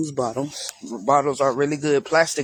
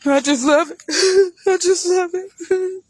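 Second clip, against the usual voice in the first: high squeal of delight with a laugh; gushing delight; 4 dB louder than usual. Real, not acted. Gardener squealing with delight over her potato plants. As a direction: squeal it, overcome with joy.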